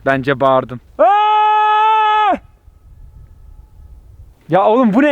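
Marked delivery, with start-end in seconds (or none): 1.0-2.4 loud high yell into the valley; shout. Real, not acted. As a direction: let out a big open shout. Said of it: "buradan bağırmadım mı ben".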